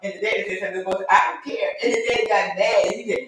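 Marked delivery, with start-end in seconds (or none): 1.1-1.7 higher-pitched retold back talk; mock childish defiance; defiant child. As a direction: toss it out like a cheeky kid. Boy talking back to parents.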